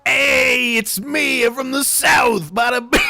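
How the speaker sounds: loud, high, hammed-up Southern accent. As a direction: shout a mock Southern accent, stretched out. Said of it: "here's my Southern accent".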